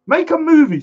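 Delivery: high-pitched mock plea; playful urging. Real, not acted. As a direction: urge him on in a high teasing voice. Repeats the joking plea.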